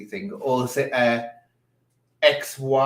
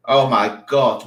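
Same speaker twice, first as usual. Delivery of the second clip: loud, slow "Oh my God" in disbelief; shocked gasp; 10 dB louder than usual. Real, not acted. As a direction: blurt it out, stunned. Shocked by the sight on screen.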